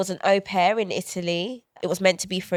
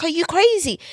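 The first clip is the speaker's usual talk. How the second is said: higher, disbelieving outburst; incredulous; 9 semitones higher than usual. Retold or imitated. Retelling her shock at the world carrying on, higher. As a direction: ask it in stunned disbelief. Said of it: "I was like".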